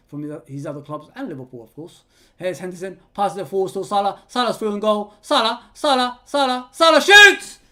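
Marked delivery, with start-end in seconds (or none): 3.1-7.6 rising from brisk call to loud high shout; mounting live football excitement; excited commentary, building. Real, not acted. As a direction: build with each name to a shouted climax.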